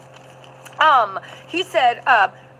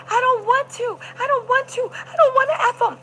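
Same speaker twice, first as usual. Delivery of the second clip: high, wailing plea retold; acted screamed refusal, pitched way up; mock screaming; 11 semitones higher than usual. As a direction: imitate a desperate high-pitched protest. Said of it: "Jane started screaming".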